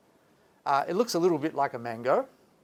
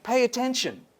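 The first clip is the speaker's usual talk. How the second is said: raised, high and firm; stern command; 9 semitones higher than usual. Retold or imitated. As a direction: snap it out like a teacher.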